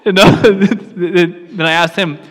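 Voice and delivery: amused, laughing at your own story. Real, not acted. Speaker retelling loud-group joke to audience, really laughing.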